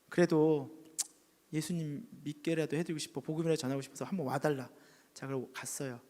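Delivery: ordinary delivery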